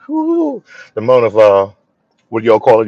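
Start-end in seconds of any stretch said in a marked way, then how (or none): 0.1-0.6 whoo. Retold or imitated. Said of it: "I was like".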